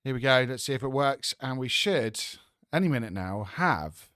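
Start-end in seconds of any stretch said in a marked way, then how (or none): none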